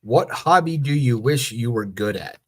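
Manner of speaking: usual speaking voice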